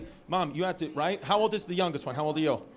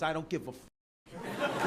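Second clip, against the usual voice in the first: slow, softer, lightly voiced indifference; couldn't-care-less woman; 3 semitones lower, 8 dB quieter, slower than usual. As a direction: imitate a woman past caring. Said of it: "that kind of face where she wakes up".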